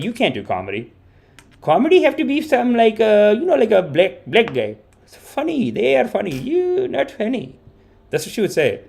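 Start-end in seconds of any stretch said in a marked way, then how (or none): 0.0-7.6 a hint of a teasing mom in broken English, higher voice; imitating mom. Mom tells her comedian son he can't do comedy, lightly voiced. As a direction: mom telling her son he isn't funny. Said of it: "She'll even tell me, she's like".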